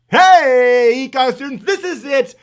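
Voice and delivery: loud high excited shout; big hype greeting. Real, not acted. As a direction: fire up the class like a rock star. Live show opener to students.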